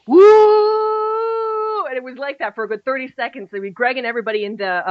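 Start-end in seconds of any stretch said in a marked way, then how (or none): 0.1-1.9 high, loud mock whoop; imitated crowd cheer. Recalling the radio play audience, a loud imitated whoop. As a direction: whoop like the whole audience at once. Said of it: "I think everybody was going".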